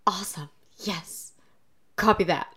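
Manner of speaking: soft mumbled dream talk; quiet drowsy radio-speak; sleep talking. Retold or imitated. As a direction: murmur it like talking in your sleep. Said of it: "I wake up to him saying".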